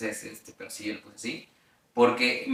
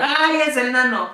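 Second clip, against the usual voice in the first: excited cry; 12 semitones higher, 6 dB louder than usual. Retold or imitated. Standing up at an award.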